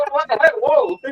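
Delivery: very high-pitched disbelief; mock shock. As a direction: act startled by a dog talking back. Acting shocked reply to the dog.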